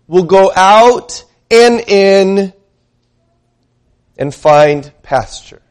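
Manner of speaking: slow, stretched scripture read with weight on saved. Acted. Preacher reads Jesus' promise to the congregation, slow and solemn. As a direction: lean into each promise slowly. Reading Jesus' words aloud.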